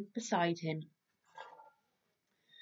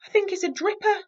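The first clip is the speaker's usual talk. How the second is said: Little Bear excited; 13 semitones higher, 8 dB louder, faster than usual. Acted. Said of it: "said Little Bear".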